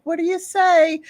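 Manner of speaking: high-pitched parental prompt; prompting mom. Retold or imitated. Mom prompts kids for thanks, coaxing. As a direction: coax manners out in a high mom voice.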